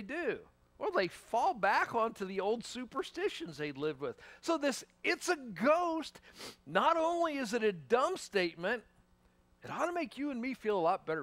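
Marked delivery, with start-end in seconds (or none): 5.0-6.2 slow, higher, mocking repeat of the line; mocking quote. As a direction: drag it out to show how silly it is.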